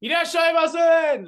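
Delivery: high, loud, drawn-out welcome call; loud Japanese shop greeting; sushi shop greeting. Retold or imitated. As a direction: call out to customers entering. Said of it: "they all try to".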